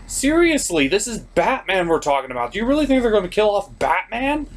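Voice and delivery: exasperated host scolding Superman's fake grief. Real, not acted. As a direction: argue him down, incredulous.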